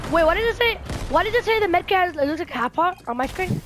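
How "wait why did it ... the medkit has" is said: high, loud confused questions; frantic, puzzled questioning; panicking gamer. Real, not acted. Confused mid-game question.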